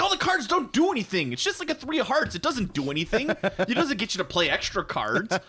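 high-pitched comic griping; mock complaint. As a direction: whine in exaggerated disbelief at the game. Acts out own complaint about poker.

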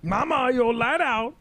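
high, slow, drawn-out cartoon voice; Princess and the Frog impression. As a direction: put on a hammed-up movie character voice. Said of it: "are you doing Princess and the Frog".

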